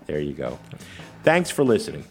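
ordinary talk, nothing marked

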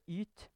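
"Ut" said as "yt"; French-accented vowel. Shown as a demonstration. Narrator showing Margot's French accent on "yt". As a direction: say "yt" with a French-style front vowel. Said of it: "Men aksenten ble hun ikke kvitt".

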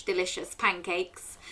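ordinary delivery

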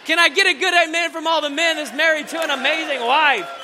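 loud, high rallying call for amens; preacher hyping the married men. Real, not acted. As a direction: whip the crowd up, loud and high. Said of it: "Y'all gotta shout louder than that".

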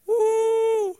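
loud high whoop; excited cheer. Retold or imitated. Cheering at acceptance list.

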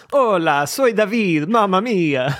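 high, sing-song Italian accent; Italian-accented Spanish. Shown as a demonstration. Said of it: "speaking Spanish in an Italian accent".

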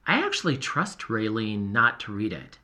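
usual speaking voice

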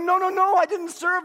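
loud, high, hurried denial; blurted, high-pitched protest; flustered protest. Retold or imitated. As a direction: re-enact waving off undeserved thanks, loudly. Flustered protest to mistaken thanks.

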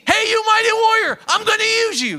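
loud, high shout of calling; the Lord calls Gideon a mighty warrior; booming call. Acted. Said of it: "So the Lord told Gideon".

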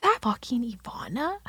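hushed, incredulous whisper; soft whispered double take; whispered disbelief. Retold or imitated. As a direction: whisper it as a dawning realisation. Retold muttered realisation to self.

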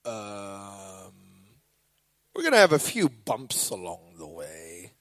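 low, casual cockpit voice; pilot voice. Retold or imitated. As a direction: do a deep, calm captain on the intercom. Pilot on the intercom.

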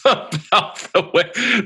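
high, loud burst of amusement; laughing too hard to get on. Real, not acted. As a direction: say it through laughter, drawn out. Amused while praising the guest.

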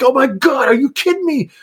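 high-pitched, appalled incredulity without extra volume; exasperated disbelief. Acted. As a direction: squeal it in mock disbelief. Acting out his exasperated reaction to videos.

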